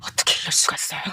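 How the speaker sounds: whispered in shock; stunned whisper. Acted. Niece whispering in disbelief at her aunt. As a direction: breathe it out, barely voiced.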